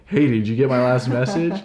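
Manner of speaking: a touch of impatient nagging; read as pushy. Retold or imitated. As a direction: sound like you're chasing them down. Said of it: "That other person could take it like".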